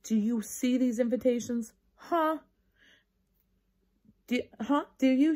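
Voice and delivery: put-on a bossy girl waving invitations at the class; a touch of angry. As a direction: demand an answer, huffily. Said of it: "waved them around real angry".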